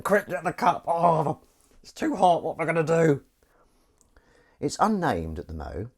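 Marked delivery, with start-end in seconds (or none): 0.0-3.2 high, loud mock-panicked voice; imagined viewers panicking. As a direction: flap in a hammed-up fluster. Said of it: "You're all going".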